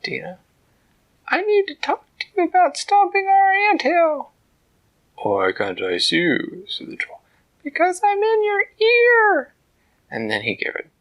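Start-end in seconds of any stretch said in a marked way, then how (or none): 1.3-9.5 high, loud squeaky little ant; tiny ant voice. A narrator's reading. Ant talking to the troll from inside his ear, high squeak. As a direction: speak up like a tiny determined ant.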